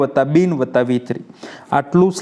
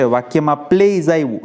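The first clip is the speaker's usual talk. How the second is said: usual speaking voice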